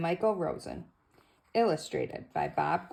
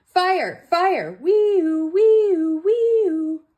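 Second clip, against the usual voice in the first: high, loud wailing siren; siren noise; 12 semitones higher, 11 dB louder, much slower than usual. Acted. Luther racing in on the rescue, siren wail. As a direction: wail it like a fire engine.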